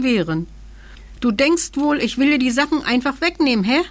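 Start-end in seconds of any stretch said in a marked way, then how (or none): 1.2-3.8 higher, quick teasing creature voice; giggly. A narrator's reading. Little creature teasing the suspicious king, giggly. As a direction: tease with a hint of a giggle. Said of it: "kicherte das Kerlchen".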